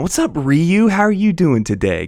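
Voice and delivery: high, loud cheerful hello; excited greeting. Real, not acted. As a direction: greet a friend with a big lift. Greets chat viewer excitedly.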